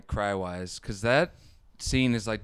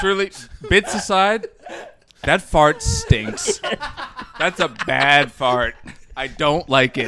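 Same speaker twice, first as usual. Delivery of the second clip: higher, louder, laughing through the words; laughing disgust; 4 semitones higher, 6 dB louder, slower than usual. Real, not acted. Friend complaining about the fart, laughing and louder. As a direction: laugh while you complain about the stink.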